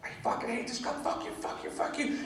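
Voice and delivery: whispered curses, soft and breathless; muttered rant. Retold or imitated. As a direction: hiss it under the breath. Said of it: "I was just like".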